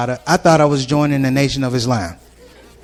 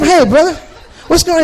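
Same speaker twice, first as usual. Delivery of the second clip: loud high call; 12 semitones higher, 5 dB louder than usual. Retold or imitated. New convert shouting to the brother over noise, high and loud. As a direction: shout to be heard, higher.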